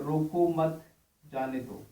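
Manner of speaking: "रोको मत" as one group, pause, then "जाने दो" falling; pause after मत. Shown as a demonstration. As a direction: break between "मत" and "जाने". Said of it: "पढ़ने का अंदाज ये है".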